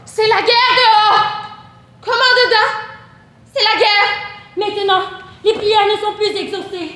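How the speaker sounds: high, loud, urgent declamation; impassioned war cry. Acted. Speaker declaring war outside and within, impassioned high cry. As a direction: cry it out as a desperate challenge. War poem cried out, violent commands.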